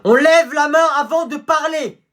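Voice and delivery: high, loud, slow rebuke; teacher snapping. Acted. Teacher scolding the new pupil for talking. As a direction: cut him off sharply.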